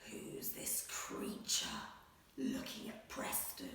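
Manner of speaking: hushed, breathy whisper; creepy storytime hush; spooky whisper. Acted. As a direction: whisper it so the wolf won't hear. Spooky aside about wolf.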